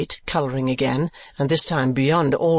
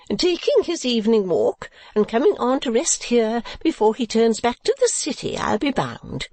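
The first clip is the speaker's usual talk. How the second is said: higher matronly voice, falling at the end; knowing chatty mother; 8 semitones higher than usual. A narrator's reading. Mother guessing at the gentleman's errand, confident.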